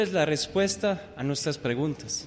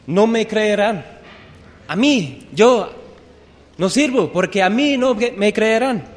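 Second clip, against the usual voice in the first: louder, higher anxious protest; protesting Moses; 4 semitones higher, 5 dB louder than usual. Acted. Preacher acting Moses telling God he is useless, louder and higher. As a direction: insist nobody will believe you.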